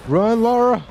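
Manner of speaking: high, loud urging shout; urgent yell. Real, not acted. Player urging Lara to run, urgent yell. Urging game character to flee.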